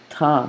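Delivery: Ta made tongue against teeth; touch the teeth for the T of Ta; dental T. Shown as a demonstration. Teacher sounding out the Arabic letter Ta, dental T. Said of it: "my tongue with my teeth".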